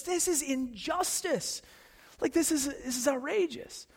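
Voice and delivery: high, slow outraged protest; furious David. Retold or imitated. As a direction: voice David's indignation, lifted high. David furious at Nathan's story.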